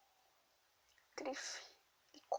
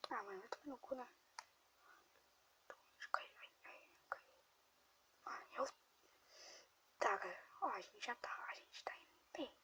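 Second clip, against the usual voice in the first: whispering; 10 dB quieter than usual. Real, not acted. Whispers while grandma sleeps.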